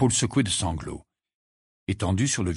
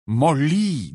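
grave, raised call of her name; 6 semitones higher, 5 dB louder than usual. A narrator's reading. Lupin approaches Molly, grave tone. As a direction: address her seriously, drawing out the name. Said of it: "dit Lupin d'un ton grave".

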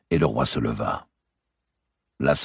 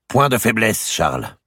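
raised, firm queenly command; hard voice; 9 semitones higher, 6 dB louder than usual. A narrator's reading. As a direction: order him sternly, settling on the name. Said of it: "la voix dure d'Isabelle d'Angleterre".

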